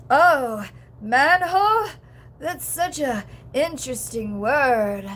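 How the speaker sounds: slow, performative, loud wondering delivery; hammed-up puzzling over each word; Anna Faris impression. Streamer showing how performative the actress sounds, exaggerated slow impression. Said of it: "it's so performative".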